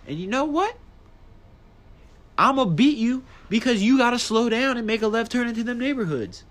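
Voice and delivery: high, heated taunting of another driver; road rage taunt. Retold or imitated. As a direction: taunt the driver you're racing. Racing the other driver.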